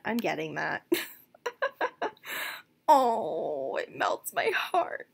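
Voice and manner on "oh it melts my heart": swooning. Real, not acted. Breathy swoon.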